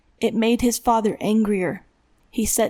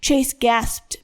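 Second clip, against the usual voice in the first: plain reading voice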